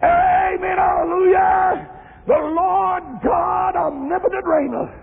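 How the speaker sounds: intoned reading swelling into high, drawn-out hallelujahs; fervent scripture preaching. Acted. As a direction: preach it like a chant, crying out the praises. Preaches the scripture at full force.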